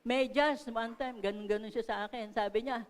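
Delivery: ordinary delivery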